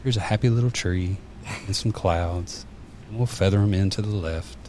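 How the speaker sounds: soothing, softened monotone; Bob Ross. Retold or imitated. Imitating the TV painter narrating his happy little tree, soothing monotone. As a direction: lull them softly, on an even note. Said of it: "soothing, monotone voice that you could".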